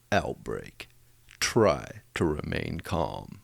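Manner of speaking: deep, slow trailer announcer; movie trailer voice. Shown as a demonstration. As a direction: drop low and drag it out like a trailer. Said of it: "In a movie voice".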